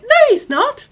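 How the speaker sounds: high, loud brushing-off voice of mum; shrill-free high mock of a dismissive mum; mock dismissive mother. Daughter imitating her mother waving off the complaint, high and loud.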